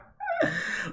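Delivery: high burst of laughter; laughing. Real, not acted. Cracking up about nodes in their chart, real laughter.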